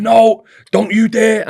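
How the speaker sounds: slow, loud, high shout; angry refusal. Retold or imitated. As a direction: bark the refusal at him. Manager yelling refusal.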